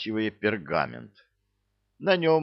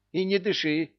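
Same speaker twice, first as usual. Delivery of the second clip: advising; 10 semitones higher than usual. A narrator's reading. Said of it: "посоветовала мама Белка".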